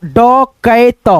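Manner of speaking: डकैत slowly split as ड, कै, त; teacher's slow breakdown of डकैत; syllable-by-syllable reading. Shown as a demonstration. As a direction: sound out डकैत piece by piece, drawn out. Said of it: "क्या पढ़ेंगे".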